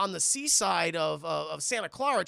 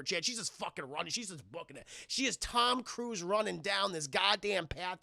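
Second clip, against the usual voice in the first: usual speaking voice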